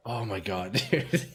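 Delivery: ordinary talk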